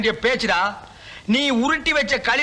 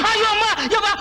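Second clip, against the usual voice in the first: higher, hammed-up cry of despair; panicked wail; 8 semitones higher, faster than usual. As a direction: wail to the heavens in mock panic. Caught, panicking in fear.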